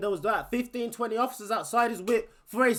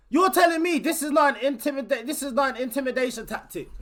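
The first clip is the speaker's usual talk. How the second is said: ordinary delivery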